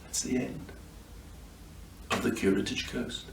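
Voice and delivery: hushed, gentle goodbye to the listeners; soft whispered sign-off. Real, not acted. Reader thanking listeners at the end, whispered softly. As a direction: let the voice fall to a whisper.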